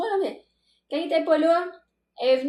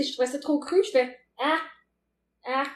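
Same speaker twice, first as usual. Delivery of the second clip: ordinary talk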